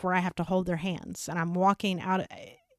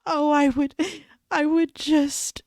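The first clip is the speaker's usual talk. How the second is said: put-on higher, halting old woman's voice; tearful old lady; 7 semitones higher, 5 dB louder than usual. Imitating the old lady near tears, higher and halting. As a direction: mimic her, choked up and halting. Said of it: "she just couldn't hardly talk".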